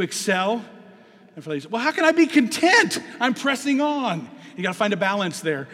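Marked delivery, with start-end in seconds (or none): 1.7-4.3 mock objecting lady. Voicing ladies' objection.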